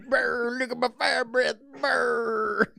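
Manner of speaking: high, strained monster roar; hammed-up dinosaur growling through the words; giant dinosaur. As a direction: roar like a fire-breathing beast. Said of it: "you're a giant dinosaur creature".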